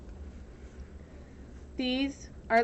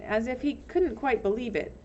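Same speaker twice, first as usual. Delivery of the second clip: usual speaking voice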